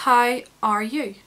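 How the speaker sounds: "How are you?" said slowly with every sound clear; careful "how are you". Shown as a demonstration. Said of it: "So you have to go from".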